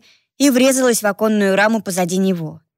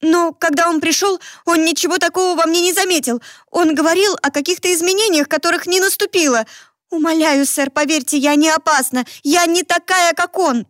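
rapid patter; 8 semitones higher than usual. Acted. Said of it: "заговорив скороговоркой".

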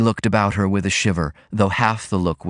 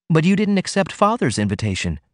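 chiding; 6 semitones higher than usual. A narrator's reading. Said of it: "she chided".

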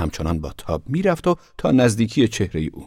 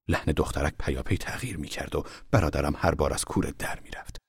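ordinary delivery

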